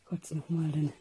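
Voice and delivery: drowsy, soft early morning voice; barely awake, murmuring at dawn; sleepy voice. Real, not acted. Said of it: "entschuldigen für die schläfrige Stimme". (verschlafene Stimme)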